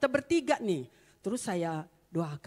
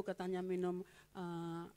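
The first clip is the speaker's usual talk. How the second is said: ordinary talk, nothing marked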